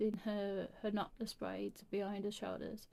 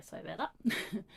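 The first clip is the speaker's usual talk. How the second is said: plain reading voice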